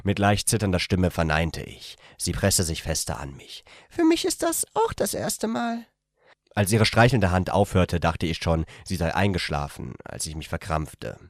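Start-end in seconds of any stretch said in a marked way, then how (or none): 3.9-5.9 much higher female character voice. A narrator's reading. The woman tells him in bed, in a much higher female voice. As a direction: voice her as a shy young woman, gently.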